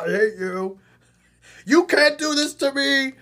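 very high, very loud screaming abuse, hammed-up; a hammed-up furious meltdown, yelling insults; mocking rage tantrum. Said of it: "It's not a good way to react".

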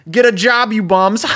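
shouted high and loud from a car window; mock yell. Passing driver yelling at two bums, loud mock shout. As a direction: bark it like a rude driver speeding by.

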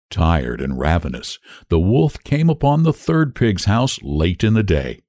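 ordinary delivery, nothing marked

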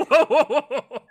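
genuinely floored by the picture; stunned whoa. Real, not acted. Guest sees the match photo, shocked.

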